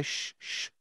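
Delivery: soft unvoiced shushing; soothing shush. To a crying kid, a soft whispered shush. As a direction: hush them gently, all breath.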